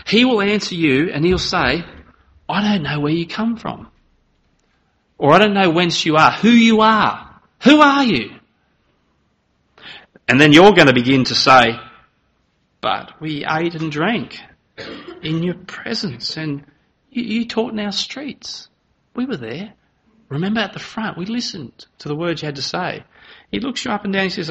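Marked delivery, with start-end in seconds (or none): 2.5-8.3 rising louder, higher, slowing on who you are; master refusing them. Acted. Master answers those outside. 12.8-23.0 pleading reminder. Acted. Rejected plead their case.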